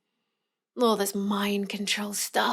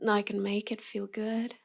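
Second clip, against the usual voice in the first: soft seductive; 7 dB quieter than usual. Acted. Seductive hypnotic promise.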